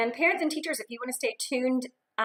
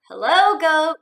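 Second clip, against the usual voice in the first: louder friendly hello; cheerful duck greeting; 5 semitones higher, 10 dB louder, slower than usual. Acted. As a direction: greet the goat brightly.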